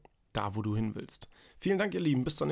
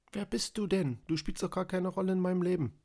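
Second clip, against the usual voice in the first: higher, cheeky dog's voice; silly dumb pet voice; 6 semitones higher than usual. Acted. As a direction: play the dog, unimpressed and cocky. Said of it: "denkt der Hund sich". (alberne Hundestimme)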